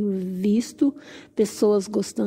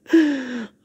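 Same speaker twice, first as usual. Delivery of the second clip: short real laugh at herself; 3 semitones higher than usual. Real, not acted. Vlogger blanks on a plant name, embarrassed laugh.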